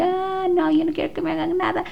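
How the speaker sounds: put-on high, fast, panicked child's cry; a boy bolting from the pastor, terrified high yell; imitating terrified yell. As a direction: squeal it like a scared boy running off. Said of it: "gritando así".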